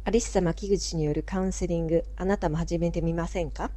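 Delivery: low, soft put-on voice; announcer voice. Shown as a demonstration. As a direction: deepen it for a radio spot. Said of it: "声が違うぞ、普段と。".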